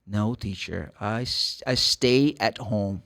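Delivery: low, soft, stumbling learner English; hesitant student. Retold or imitated. Student blocking when the teacher asks, hesitant. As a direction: stumble slowly through it, pitched low and subdued. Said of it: "Like this".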